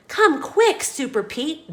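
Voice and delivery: high urgent call for help; urgent plea. A narrator's reading. Mayor calls Super Pete for help, high and urgent. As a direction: beg him to hurry. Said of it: "the mayor says".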